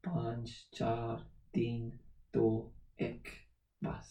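a soft slow countdown in Urdu. Retold or imitated. Father counting down to his son in Urdu, soft and firm. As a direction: count down quietly, a warning. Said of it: "Start counting down".

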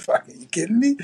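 high-pitched, retold astonishment; thrilled disbelief. Teenager answers a surprise job offer, retold thrilled disbelief. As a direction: re-enact delighted disbelief, pitched well up.

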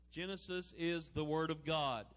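ordinary delivery

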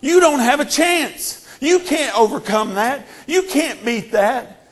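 slow mocking devil's jeer. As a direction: drag out the taunt like a gloating devil. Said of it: "and mocking and saying".

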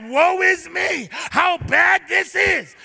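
mock devil wail. Preacher mimicking the devil screaming at believers, mock wail. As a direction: lightly voice the devil's moaning scream. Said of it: "the devil wants to scream at you".

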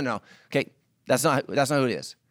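usual speaking voice

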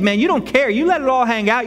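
normal talking voice

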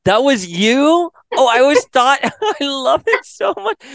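high, loud, amazed disbelief; surprised delight. Real, not acted. Interviewer learning the voice was real, high amazed delight. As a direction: gush with genuine surprise.

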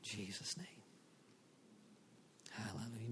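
soft whispered close of prayer. Real, not acted. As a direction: breathe it in a whisper.

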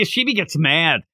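normal talking voice

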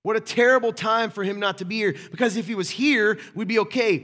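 high, loud, acted alarm; panicked complaint. Disciples fear dying without Jesus, loud panicked wailing. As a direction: cry out in hammed-up panic over the storm.

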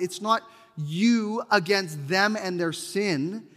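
ordinary talk, nothing marked

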